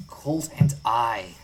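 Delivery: slow, deliberate correction; dry, slowed-down grammar fix; pedantic correction. Acted. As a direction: correct him with a weary sigh. Colt corrects his grammar.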